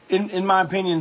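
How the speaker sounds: normal talking voice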